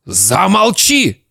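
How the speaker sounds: father's angry shout. Acted. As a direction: silence the boy sharply. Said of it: "закричал папа".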